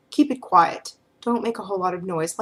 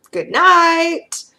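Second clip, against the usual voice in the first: high, loud lilting good night; sing-song good night; 10 semitones higher, 10 dB louder than usual. Retold or imitated. Feeder sends the stressed client off to sleep, loud and sing-song. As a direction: sing it out like tucking someone in.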